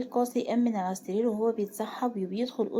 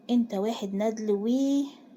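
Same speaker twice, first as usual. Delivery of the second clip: ordinary delivery, nothing marked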